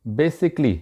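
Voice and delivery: basically in three syllables, BAY-sik-lee; correct pronunciation. Shown as a demonstration. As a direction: say basically with the 'a' before 'lly' dropped. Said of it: "ये गलत है".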